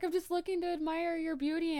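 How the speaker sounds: high, sweet lovey voice; sappy boyfriend. Retold or imitated. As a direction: play the doting husband. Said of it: "He's like".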